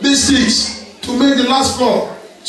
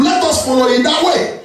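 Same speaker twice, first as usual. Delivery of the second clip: fervent preaching; 6 semitones higher than usual. Real, not acted. Preaching climax.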